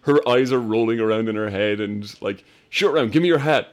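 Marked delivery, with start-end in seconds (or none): 2.7-3.7 urgent Willie. Retold or imitated. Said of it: "And Willie's like".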